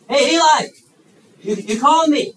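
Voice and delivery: high, loud call to the priest; roused boy calling. Acted. Boy returns to the priest second time, calling out.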